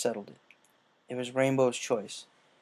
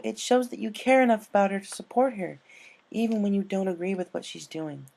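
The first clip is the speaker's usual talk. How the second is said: lightly raised character voices in a tense talk; pony character voices; 9 semitones higher, 6 dB louder than usual. Acted. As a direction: argue gently as worried friends. Character dialogue between ponies.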